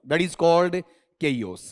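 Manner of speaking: chaos said slowly as keyos; drawn out, teacher modelling chaos; slow classroom demo; slow model pronunciation. Shown as a demonstration. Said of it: "teacher models pronunciation".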